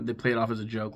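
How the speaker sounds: ordinary delivery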